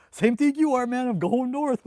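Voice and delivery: high, very loud retold banter; excited loud reply. Hometown friend answering him in Kuwait, loud and excited. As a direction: call it back boisterously, pitched well up.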